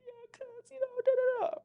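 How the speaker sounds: very high-pitched imitation of a wailing friend; exaggerated tearful babble; mock whiny wail. Imitating her upset friend's wailing.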